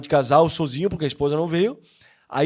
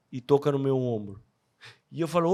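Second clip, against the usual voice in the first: ordinary talk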